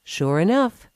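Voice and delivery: ordinary delivery, nothing marked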